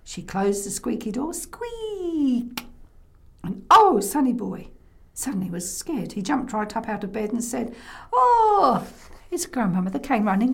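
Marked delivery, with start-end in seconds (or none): 1.3-2.7 high, falling creak of a door; door squeak. Acted. 8.1-8.9 loud, high "oh" of alarm; frightened cry. Acted. Scared boy jumps from bed.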